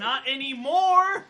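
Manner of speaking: slow, loud high yell; loud shout. Acted. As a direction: bellow the punchline. Shouted dark punchline.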